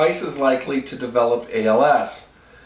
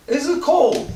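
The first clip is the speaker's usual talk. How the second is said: high squeal from the cold; 12 semitones higher than usual. Real, not acted. Ice under his clothes.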